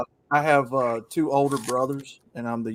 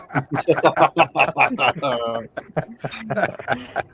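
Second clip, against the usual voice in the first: laughing. Real, not acted. Laughs at the sister joke.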